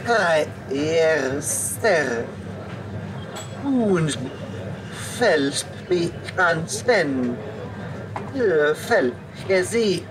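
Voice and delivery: slow, warped reversed recording; reversed playback. Shown as a demonstration. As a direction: sound like a tape played backwards. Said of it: "hört sich an, was er sagt".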